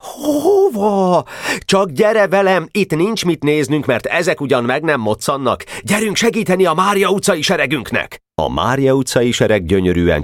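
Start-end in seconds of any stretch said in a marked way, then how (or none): 0.0-8.2 high, animated dialogue voice; excited urging. A narrator's reading. Boy hurrying a comrade to help their troop, excited urging. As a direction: urge them on in a high voice.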